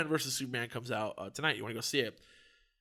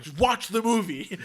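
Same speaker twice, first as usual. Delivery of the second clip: raised, higher voice in comic exasperation; retold loud order; 6 semitones higher, 6 dB louder than usual. Telling the phone man off, loud comic order. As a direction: order him, laughter close behind.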